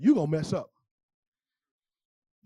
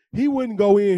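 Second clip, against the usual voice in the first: louder, punched outrage; emphatic preaching; 3 semitones higher, 10 dB louder than usual. Real, not acted. Pastor telling the congregation, loud and emphatic. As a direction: hammer the refusal home. Preacher stressing brother's refusal.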